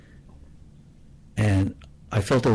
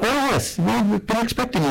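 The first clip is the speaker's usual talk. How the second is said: high, singsong cheerful greeting; chirpy pharmacy clerk, pitched way up; cheery; 13 semitones higher than usual. Retold or imitated. Young pharmacist greeting an expected customer, very cheery. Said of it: "who was very cheery".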